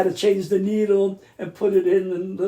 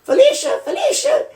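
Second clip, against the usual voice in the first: much higher, louder squawked name; squawking mynah bird; 7 dB louder than usual. Retold or imitated. Imitating the aunt's mynah calling by her telephone, squawky. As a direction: call out the name like a mimicking bird. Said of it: "and the bird would go".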